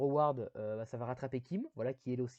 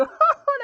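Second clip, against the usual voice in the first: embarrassed laugh; 16 dB louder than usual. Real, not acted. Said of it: "mon accent anglais, il est terrible".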